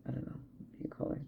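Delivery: quick whispered aside to self. Real, not acted. Unsure of the word, quick whispered aside. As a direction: whisper it fast, half to yourself.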